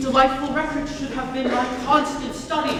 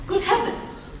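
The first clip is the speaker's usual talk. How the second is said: sudden high burst of surprise; surprised exclamation; 10 semitones higher, slower than usual. Acted. Jack realizing his name problem, surprised exclamation. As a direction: struck by a forgotten point.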